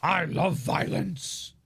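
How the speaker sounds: lightly voiced slow, relishing troll; troll cab. Quoting troll cab on HOA violations, slow relishing voice. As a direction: savour each word slowly as a troll. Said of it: "troll cab was just like".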